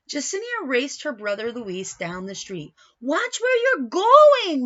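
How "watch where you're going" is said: high, loud and slow warning call. Acted. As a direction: call out slowly and loudly after the running kids. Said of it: "Mommy said".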